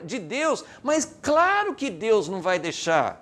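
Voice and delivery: high, emphatic preacher's delivery; fervent sermon voice; impassioned preaching. Real, not acted. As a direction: press the argument home with rising fervor. Preaches at full force.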